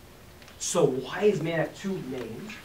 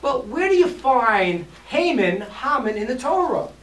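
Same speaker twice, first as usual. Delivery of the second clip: Talmud's question; 5 semitones higher, 6 dB louder than usual. Acted. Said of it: "The Talmud says".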